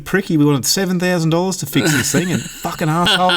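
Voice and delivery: put-on high, ranting old man quote; angry old bloke. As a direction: mimic a furious pensioner cursing the mechanic. Old man ranting about mechanic.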